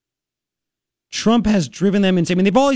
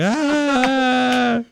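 higher mock cry of fright; mock scream; 8 semitones higher than usual. Streamer mimicking someone chased by bees, higher mock cry. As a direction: squeal like you're chased by bees. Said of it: "You start screaming in that aspect".

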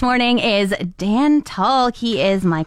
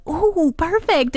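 imitating grandma; 10 semitones higher, much slower than usual. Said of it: "she would be like".

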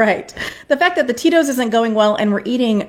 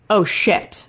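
laughing; 6 semitones higher than usual. Retold or imitated. Husband learns it's twins.

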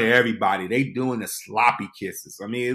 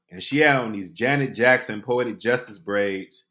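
normal talking voice

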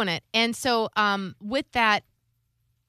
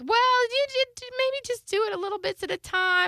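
very high, wavering hedge; mock mom voice. Imitating mom hinting about the money, high hesitant mock voice. Voicing her mom's hesitant reply.

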